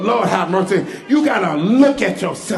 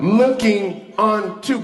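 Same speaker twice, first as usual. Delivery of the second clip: looking unto drawn out slowly; slow call-and-response reading of the text; much slower than usual. Shown as a demonstration. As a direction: stretch out looking unto for the church. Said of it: "read that with me".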